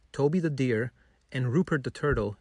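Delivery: plain reading voice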